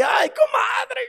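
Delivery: high, far-off complaining wail; crying to the comadre; whiny plea. Acted. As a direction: moan to your friend for sympathy. Venting to the comadre.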